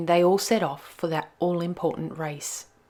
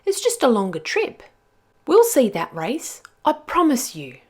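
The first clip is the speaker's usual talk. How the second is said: Nell's voice; 6 semitones higher, 3 dB louder than usual. Acted. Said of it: "she said".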